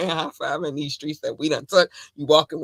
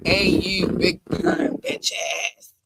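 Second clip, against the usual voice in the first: very slow, stretched shout-out; drawn-out excited call; 4 dB louder, much slower than usual. Real, not acted. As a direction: drag every word out, hyped. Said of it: "I got excited".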